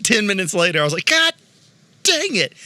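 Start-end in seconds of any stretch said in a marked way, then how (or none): 1.1-2.5 frustrated outburst. Retold or imitated. Frustrated outburst while building.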